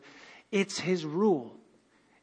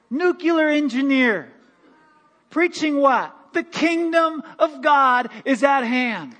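louder, higher, slow preaching swell; preacher firing up the congregation, louder and higher; excited preaching; 6 semitones higher, 4 dB louder than usual. Real, not acted. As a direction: build the fire in the room.

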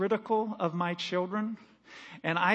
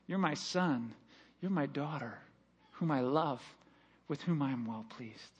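soft, lower tender blessing; tender father's voice; 7 semitones lower, 10 dB quieter than usual. Retold or imitated. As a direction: gently tell your child you love them. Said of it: "Can you imagine God saying that about you?".

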